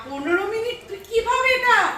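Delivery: high, loud astonished question; amazed disbelief. Real, not acted. To the family, astonished high loud disbelief. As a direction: burst out in shocked disbelief. Amazed at fifteen-minute bath.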